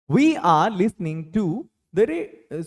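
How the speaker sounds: very slow, each word stretched; slow dictation. Shown as a demonstration. Teacher slowly dictating a sentence to his students, word by word. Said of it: "slow model sentence".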